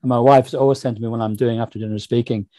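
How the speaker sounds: usual speaking voice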